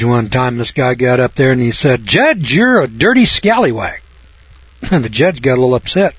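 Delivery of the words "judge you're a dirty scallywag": higher-pitched mock accusation; defiant accuser. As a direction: hurl the insult at the judge in a raised pitch. Acts out man insulting a judge.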